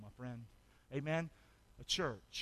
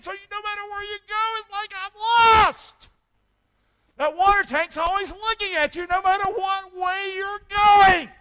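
high, loud preaching cry; full-force preaching; 13 semitones higher, 14 dB louder than usual. Acted. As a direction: lift the crowd with a loud call. Preaching at full force about the tank.

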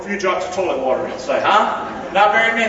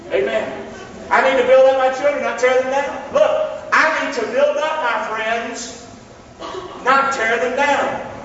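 ordinary talk

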